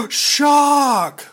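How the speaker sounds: gasp, then a loud, high "shock"; sarcastic, exaggerated shock; mock shock. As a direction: feign astonishment with a big gasp. Sarcastic feigned astonishment at obvious answer.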